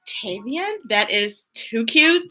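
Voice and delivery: ordinary delivery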